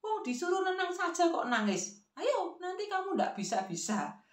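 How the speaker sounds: high nagging grandmother; high-pitched scolding. Acted. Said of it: "si nenek terus saja berteriak".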